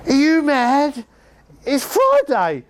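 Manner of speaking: loud, high, slow incredulous impersonation; the manager aghast at cash on a Friday; slow, strained, high outraged voice; John Weller's accent. Retold or imitated. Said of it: "I can't do the accent properly now".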